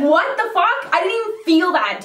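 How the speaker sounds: can't believe it didn't hurt; shocked. Real, not acted. Client amazed the Botox shot was painless, shocked.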